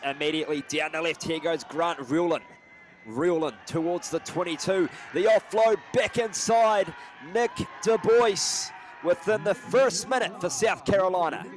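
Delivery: live try call building to a high, loud shout; excited play-by-play. Real, not acted. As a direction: build from quick commentary into a roaring score call.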